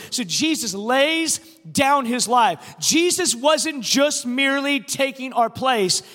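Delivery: loud, driving pulpit delivery; impassioned, emphatic sermon cadence; forceful preaching. Real, not acted. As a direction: drive each phrase home to a congregation.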